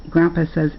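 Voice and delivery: plain reading voice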